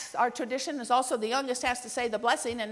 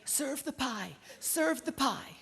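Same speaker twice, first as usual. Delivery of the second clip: little voice; 2 semitones higher, 5 dB quieter, much slower than usual. Retold or imitated. Daughter prompting mother after the frozen meal, little voice. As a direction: softly pipe up like a little kid. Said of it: "I heard this little voice".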